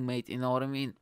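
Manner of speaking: usual speaking voice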